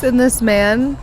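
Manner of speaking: normal talking voice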